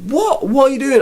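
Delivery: high, startled double take; shocked. Retold or imitated. The woman catching Hogan mid press-up, shocked. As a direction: squeak it out in disbelief. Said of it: "It's like".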